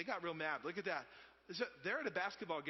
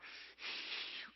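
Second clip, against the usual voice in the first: practiced breathing-exercise puff; demonstrated breathy exhale; imitated Lamaze breath; 5 dB quieter than usual. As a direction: blow out like a coached birth partner. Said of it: "I'm kinda getting that down".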